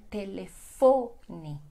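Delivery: "Telefone" very slowly, te-le-FO-ni; stress on FO. Shown as a demonstration. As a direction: draw out FO in "Telefone". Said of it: "a mais forte é fo".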